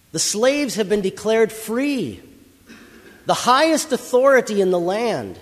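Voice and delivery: high, loud heralding of freedom; yelled proclamation. Acted. Kind house owner yelling out to slaves that they are free, loud proclamation. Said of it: "And he yells out to you".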